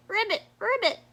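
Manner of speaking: high croaking ribbit; frog call. A narrator's reading. Narrator voicing Froggy calling to his friend Turtle, high frog call. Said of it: "Froggy called to Turtle".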